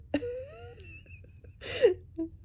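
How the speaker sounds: shrieking laugh. Real, not acted. Cracking up at Kat.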